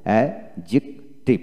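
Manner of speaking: অ্যাডজেক্টিভ slowly as "a... jik... tiv", no d; syllable by syllable. Shown as a demonstration. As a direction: break অ্যাডজেক্টিভ into three spaced syllables, d silent. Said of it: "উচ্চারণটা কী হবে?". (ভেঙে ভেঙে ধীরে অ্যাডজেক্টিভ, ডি ছাড়া)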